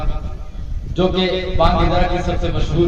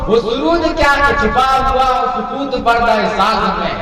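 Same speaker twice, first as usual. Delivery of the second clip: ghazal recitation; 4 semitones higher than usual. Acted. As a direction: chant each verse with lilting tarannum. Recites Iqbal ghazal at mushaira.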